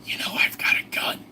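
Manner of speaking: sneaky stage whisper; hushed, voiceless whisper. Acted. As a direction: whisper it like a secret. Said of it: "I just repeatedly whisper to them".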